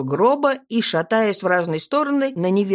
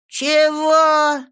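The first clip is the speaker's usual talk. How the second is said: put-on confused drunk; 8 semitones higher, 5 dB louder than usual. A narrator's reading. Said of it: "не понял Гуляка".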